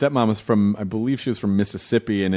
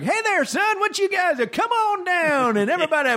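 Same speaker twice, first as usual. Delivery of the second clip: high-voiced big Southern guy. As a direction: invite everyone in like a jolly Southern host. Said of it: "they have these, like".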